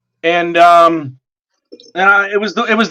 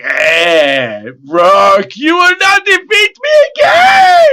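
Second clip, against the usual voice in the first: much higher, loud vengeful villain yell; villain's shout; 8 semitones higher, 3 dB louder, slower than usual. Retold or imitated. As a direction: scream it as the defeated devil. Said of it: "It's like".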